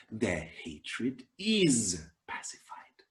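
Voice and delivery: hushed, slow whisper to close the verse. Acted. Teacher whispers the verse's peaceful ending, slowly. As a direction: let the line settle into a whisper.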